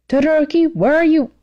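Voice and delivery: high call after a wandering student. Acted. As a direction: call him back across the field.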